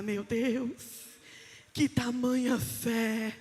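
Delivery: low, hushed, slow wonder; marvelling Jesus. Acted. Jesus sending the believing man home, hushed and tender. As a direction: let each phrase sink in slowly.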